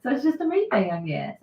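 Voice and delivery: ordinary talk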